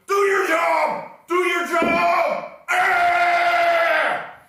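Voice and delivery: frantic high shout, very loud and slow; screaming coach. Retold or imitated. Philly fan screaming at a nervous pitcher, all-out shout. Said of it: "have Mark screaming at him".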